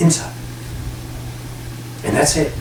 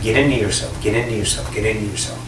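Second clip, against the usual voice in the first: soft insistent repeat; 8 semitones lower, 7 dB quieter, faster than usual. Real, not acted. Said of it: "repeated instruction fading".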